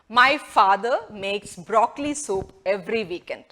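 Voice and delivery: very slow, carefully spaced; slow model sentence. Shown as a demonstration. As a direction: read it slowly for learners. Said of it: "model sentence".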